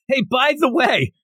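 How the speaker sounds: high mock yell of a warning; retold yell. Demon calling back a warning, mock yell. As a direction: call it out high as a fleeing demon would. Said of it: "she's, like, yelling as she's going".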